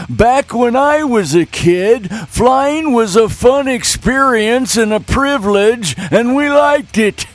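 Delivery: put-on old man voice. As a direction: grumble like a cranky grandpa. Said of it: "I will read these with my old man voice".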